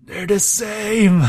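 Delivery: high, loud mock scream, stretched out; acting out a comic scream of discovery; imitated scream. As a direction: cry it out slowly like a hammed-up shriek. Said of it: "and he screams".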